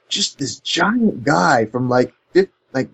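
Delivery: ordinary talk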